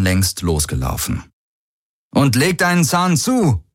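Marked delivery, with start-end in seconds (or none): 2.1-3.6 high-pitched urging call. A narrator's reading. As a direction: call back to hurry him along. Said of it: "rief er". (hoch und antreibend rufen)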